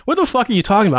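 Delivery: high-pitched retold disbelief; incredulous retort. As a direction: act out a drunk friend's baffled question. Retold drunk friend doubting him.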